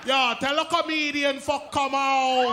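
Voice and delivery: slow, loud Jamaican patois threat; drawn-out Jamaican gunman calling for the comedian; Jamaican accent. Retold or imitated. Angry Jamaican man calls the comedian out at the door. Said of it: "he with the gun in his hand like".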